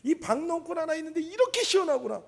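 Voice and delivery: high, happy exclamation; delighted. Retold or imitated. Preacher voicing Jonah delighted by the shade of the vine. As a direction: relish the cool shade. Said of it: "요나가 너무너무 기뻐요".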